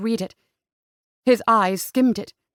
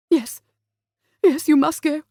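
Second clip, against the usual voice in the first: urging; 5 semitones higher, 3 dB louder than usual. A narrator's reading. Anxious mother urges daughter to go.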